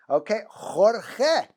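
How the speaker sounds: slowly drawn-out Jorge, KHOR-kheh, both g's throaty; slow Spanish Jorge. Shown as a demonstration. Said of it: "It's Jorge".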